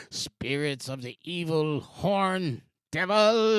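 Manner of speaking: slow, ominous mock-prophecy; slow hammed-up doom voice, laughing at the end; mock spooky warning. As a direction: ham it up like a doom-laden soothsayer. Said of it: "when I was making fun".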